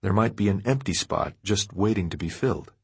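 ordinary delivery

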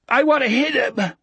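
put-on high, muffled boyish plea to fight; muffled cry. A narrator's reading. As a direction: cry out eagerly through a blocked-up voice. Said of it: "cried in his new muffled voice".

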